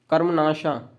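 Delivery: normal talking voice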